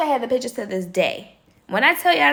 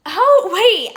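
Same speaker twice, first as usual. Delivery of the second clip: shocked; 9 semitones higher, 6 dB louder than usual. Retold or imitated. Shocked at the used towel.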